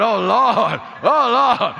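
loud, high comic alarm; hammed-up dread at being caught; mock panic. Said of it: "I said".

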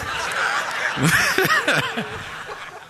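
a high burst of real laughter. Real, not acted. Laughing at his own story.

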